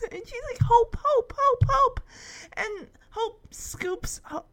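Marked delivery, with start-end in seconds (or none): 0.6-2.0 high, eager repeated name; excited cat. Retold or imitated. Sassy runs to Hope, high excited calling. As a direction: call the name joyfully over and over.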